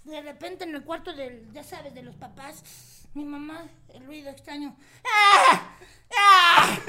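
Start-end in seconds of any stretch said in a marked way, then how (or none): 5.0-6.8 loud exaggerated moan; mock sex moan, loud. Mom's moans in sex anecdote.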